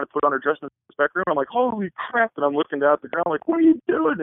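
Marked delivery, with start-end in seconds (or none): 1.5-2.3 high startled holy crap; pitched-up stunned gasp of words; shocked exclaim. Retold or imitated. Shocked at half-naked bride. 3.5-4.2 much higher, squeaky mock-alarmed question; pitched way up in retold panic; flustered disbelief. Averting eyes from naked bride.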